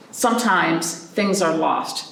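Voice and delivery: slow, weighty "Sometimes things are lost"; storybook refrain. A narrator's reading. Repeating the book's refrain to children, slow and heavy. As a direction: lean on "Sometimes", drawn out slowly.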